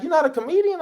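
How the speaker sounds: radio host amazed he isn't a comedian; surprised question. Retold or imitated. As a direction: sound amazed he isn't a comic.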